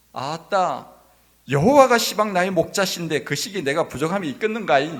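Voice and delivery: loud, animated Jeolla dialect impression. Shown as a demonstration. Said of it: "전라도 버전으로". (크고 과장된 전라도 사투리 흉내)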